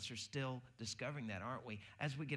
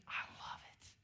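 whispered delight; 4 dB quieter than usual. Retold or imitated. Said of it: "She goes".

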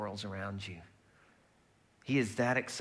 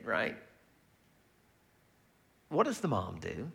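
ordinary talk, nothing marked

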